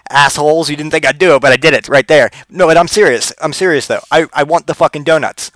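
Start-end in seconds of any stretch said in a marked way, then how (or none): none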